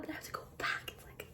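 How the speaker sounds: breathy whispered regret; sad whisper. Real, not acted. Vlogger tells viewers she must leave London, whispering sadly.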